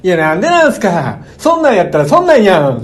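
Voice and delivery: loud mock Kansai tsukkomi retort; 関西のツッコミ. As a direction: imitate an overdone comic comeback, high and loud. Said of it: "ツッコミやねん". (大げさな関西弁ツッコミの真似)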